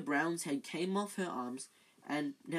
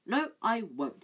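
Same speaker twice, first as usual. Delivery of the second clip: sharp, higher, louder refusal; severe; 7 semitones higher, 6 dB louder, slower than usual. Acted. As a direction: snap a firm no. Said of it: "suddenly severe".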